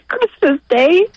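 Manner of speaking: tearful, halting and high-pitched; real tearful emotion. Real, not acted. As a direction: overcome with emotion, fighting back real tears. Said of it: "I wasn't expecting to get so emotional".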